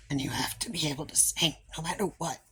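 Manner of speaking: fast whisper through a sore throat; fast, hoarse whisper, no voice left; real whisper. Real, not acted. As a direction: whisper it quickly, voice worn out. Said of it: "I can just talk normally".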